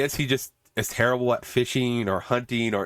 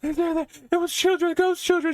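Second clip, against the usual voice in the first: very high-pitched hammed-up panic; mock panic. Woman waking her friend about ghost children, mock panic. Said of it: "she starts panicking".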